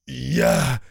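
loud high rasping fury; raging hoarse shout. Acted. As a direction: scream your hatred at God. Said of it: "прохрипел". (хриплый яростный крик)

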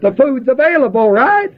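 high, rising, laughing delivery; amused punchline. Real, not acted. Preacher tells congregation the food is there, laughing.

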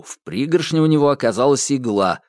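ordinary delivery, nothing marked

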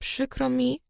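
soft, sorry murmur; tearful apology. A narrator's reading. Friend wiping tears apologises to patient, tearful. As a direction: say it softly, near tears. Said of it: "otarła łzy wierzchem dłoni".

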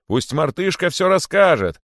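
high, loud demand; crowd's cry. A narrator's reading. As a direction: call out as an eager crowd. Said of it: "закричали кролики". (крик толпы кроликов)

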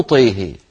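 "طيه" low, vowels leaning to e; with imala. Retold or imitated. Ibn Masud quoting the Prophet's imala. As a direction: say teeheh, the a tilted to e. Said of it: "أقرأه".

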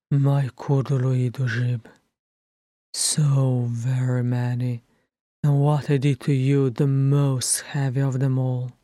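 subdued, heavy confession; remorseful Thorin. A narrator's reading. Thorin confessing his regrets to Bilbo, subdued remorse.

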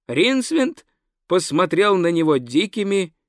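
ordinary talk, nothing marked

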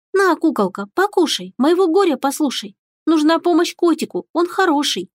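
put-on high, singsong little girl; a small girl coaxing a doll. A narrator's reading.

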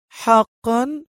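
high, rising sarcastic query; mocking. A narrator's reading. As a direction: question it mockingly, pitch swooping up. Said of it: "قال شوكت في سخرية". (بسخرية)

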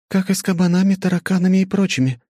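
high, loud goddess voice. A narrator's reading. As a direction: answer brightly as the goddess. Tsukiyomi answers, high voice.

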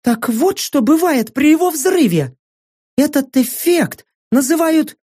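high-pitched wondering female voice; amazed Saka. Acted. Saka realising what the core's blast does, high amazement.

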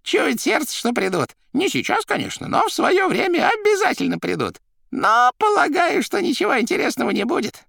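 very high, loud character voice; excitable Koroviev. A narrator's reading. Koroviev confirming his suspicions, high and excitable. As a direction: exclaim theatrically in a high voice.

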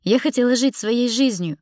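high, loud, anguished outburst; shouted protest. Acted. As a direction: cry it out in protest. Continues shouting back at him.

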